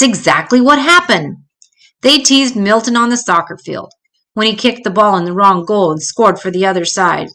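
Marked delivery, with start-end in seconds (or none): none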